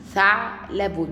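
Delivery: "ثعلب" broken by a stop after the ayn; sukun on the ayn. Shown as a demonstration. Teacher gives the sukun example, pausing at ع inside "ثعلب". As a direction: halt on the vowelless ع in "ثعلب", then finish. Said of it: "وعلامة السكون".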